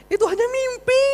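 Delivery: extremely high, loud squeal; high mock wail. Preacher lamenting it was only a dream, high mock wail. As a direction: squeal the whole line way up at the top of the voice.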